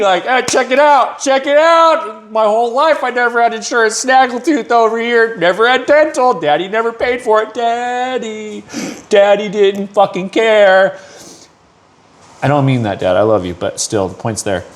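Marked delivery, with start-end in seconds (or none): 0.2-2.3 high, drawn-out showing off; mock proud brag. Worker boasting to everyone about his insurance, high and slow. 4.0-11.0 a hint of a whiny kid, pitched up; mock his snaggletoothed younger self. Man mocking his uninsured childhood, slightly high and whiny.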